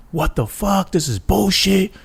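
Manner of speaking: venting like a cop fed up with being told to stand down; high-pitched retold outrage at a wasted shift; pissed-off cop. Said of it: "They get pissed off, livid".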